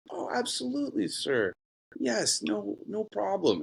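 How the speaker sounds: high, sugary customer-service voice; phone-polite advisor. Retold or imitated. Advisor on the phone to a customer, high fawning politeness. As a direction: lay the politeness on thick for the client.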